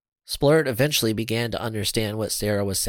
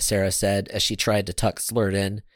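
ordinary delivery

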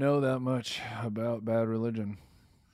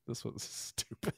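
whisper; 11 dB quieter than usual. Real, not acted. Aside about the song.

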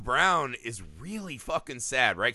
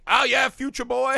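high, loud mock jeer; 9 semitones higher, 6 dB louder than usual. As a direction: imitate someone sneering in disbelief at a kid. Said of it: "He's like mocking him".

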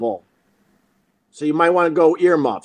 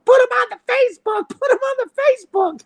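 much higher, fast mock yell in another woman's voice; imitating a yelling neighbor; faster than usual. Angry neighbor yelling at the parked driver, mock yell. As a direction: holler it like the angry lady on the street.

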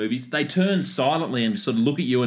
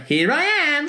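goofy alien voice; 14 semitones higher, much slower than usual. Retold or imitated. Alien at window, mocked.

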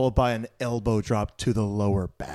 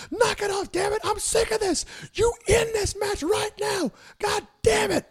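very high, strained shouting rant; furious referee yell. Retold or imitated. Referee yelling at Triple H to end the match, furious high shout. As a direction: scream it like a fed-up referee losing it. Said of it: "you can just hear the passion in his voice".

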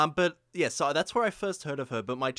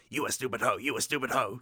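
imitating a friend's impression; 2 semitones lower than usual. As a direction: chant it like a bad singer impression. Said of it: "doing an impression of her".

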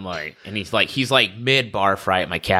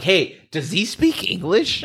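high, slow, drawn-out puzzled question; 9 semitones higher, slower than usual. Retold or imitated. Medic asks the friend about the patient, puzzled. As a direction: ask it high and stretched out, baffled.

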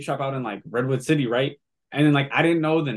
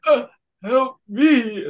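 weak plea; 8 semitones higher, 4 dB louder, slower than usual. Retold or imitated. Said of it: "and you're just like".

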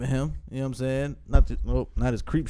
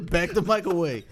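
ordinary talk, nothing marked

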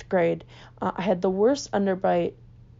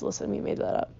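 whispered confession; 2 semitones lower, 7 dB quieter than usual. Pretend. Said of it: "We just tell our kids".

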